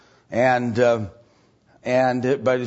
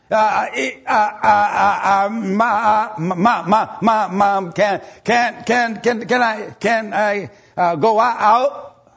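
higher, slow halting stutter on every sound; stuttering imitation; 8 semitones higher, 4 dB louder, slower than usual. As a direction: imitate a boy stuttering badly. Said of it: "That's how he talked".

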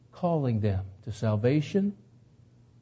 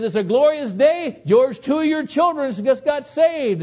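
high, loud, beaming news; joyful announcement; 12 semitones higher, 6 dB louder than usual. Retold or imitated. Wife telling George his children were saved, bright and elated. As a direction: burst out with the good news.

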